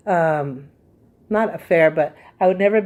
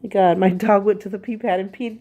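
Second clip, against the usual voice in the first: usual speaking voice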